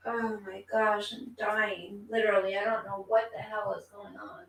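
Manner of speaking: barely getting the words out, sick; feeling unwell. Real, not acted. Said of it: "I'm just not feeling that good".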